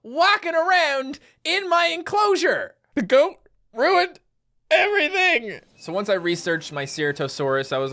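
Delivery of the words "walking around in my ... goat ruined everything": slow, high, emphatic exasperation; exasperated outburst. Acted. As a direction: draw out each word in mock outrage, pitch climbing. Rants about goat in enclosure.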